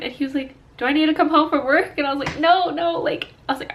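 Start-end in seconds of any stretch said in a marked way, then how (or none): 0.8-2.0 higher, faster, louder worried husband. Retold or imitated. Husband's concern retold. 2.4-3.0 higher, louder quick refusal; insistent no. Retold or imitated. Her quick refusal retold.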